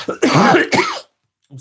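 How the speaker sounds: coughing while apologising; slow speech through a cough. Real, not acted. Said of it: "sorry about the coughing".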